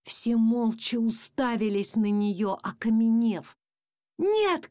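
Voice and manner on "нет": high-pitched cry of refusal, modest volume. A narrator's reading. Boy protests losing his pet, crying out. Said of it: "крикнул я".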